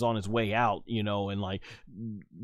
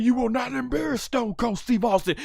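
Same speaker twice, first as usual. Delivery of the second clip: high imitated angry promo; hammed-up wrestler's furious warning; Stone Cold; 12 semitones higher than usual. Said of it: "the way Austin was mad".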